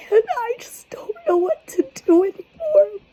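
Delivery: high, tearful whisper, voice cracking; can't hold the tears back any longer; real crying, words barely voiced; breaking into tears. Real, not acted. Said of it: "I'm trying so hard not to cry".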